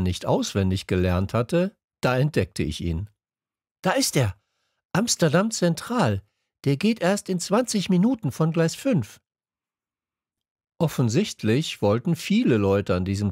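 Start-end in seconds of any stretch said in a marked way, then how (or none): 3.8-9.2 higher, eager and bright-eyed announcing; excited discovery. A narrator's reading. Boy spotting the Amsterdam train, excited. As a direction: blurt out the find.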